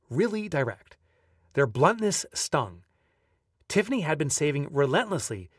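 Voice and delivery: ordinary talk, nothing marked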